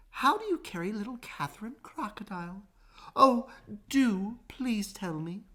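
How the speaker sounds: small kangaroo begging politely; pleading kangaroo. A narrator's reading. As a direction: beg sweetly to be told.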